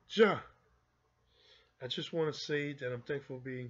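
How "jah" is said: proclaimed Jah. Real, not acted. Calling out the Most High.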